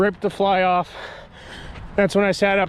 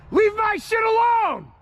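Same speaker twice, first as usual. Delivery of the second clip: furious yell into the dark; shouting; 14 semitones higher, slower than usual. Real, not acted. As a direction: yell at them to back off. Yelling at figures near tent.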